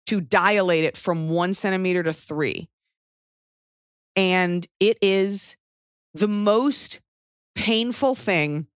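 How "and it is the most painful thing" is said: slow, drawn-out emphasis on most painful; dramatic slow emphasis. Real, not acted. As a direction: stretch out the most painful thing. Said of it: "It was so horrific".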